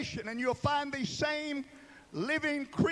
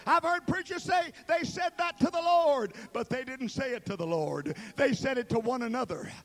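ordinary talk, nothing marked

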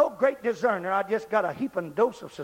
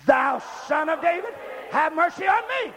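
raised, measured congregational call; louder call; 6 semitones higher than usual. Shown as a demonstration. Leading the church to say it, louder call. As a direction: call it out firmly, short of desperation. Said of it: "Louder and louder and louder".

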